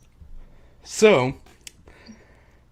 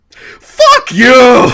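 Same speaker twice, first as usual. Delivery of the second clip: much higher, very loud yell; shouted curse; 12 semitones higher, 14 dB louder than usual. Retold or imitated. Henry shouts at Tommy to break the tension. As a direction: blast it out as a joke. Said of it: "Henry just goes".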